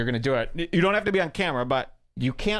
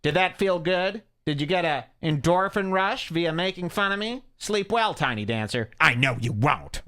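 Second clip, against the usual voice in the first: bitchy taunt; 7 semitones higher than usual. Retold or imitated. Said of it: "he says".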